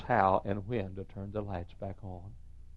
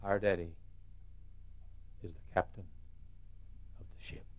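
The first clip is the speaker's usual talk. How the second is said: hushed; 3 semitones lower, 11 dB quieter than usual. Acted. Sermon climax softened.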